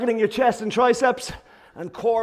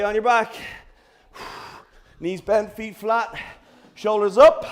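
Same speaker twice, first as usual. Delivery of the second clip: normal talking voice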